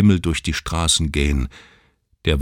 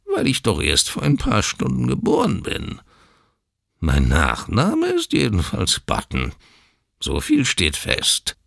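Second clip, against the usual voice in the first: whiny voice; 5 semitones higher than usual. A narrator's reading. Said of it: "die weinerliche Quengelstimme".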